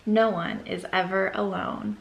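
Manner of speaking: ordinary delivery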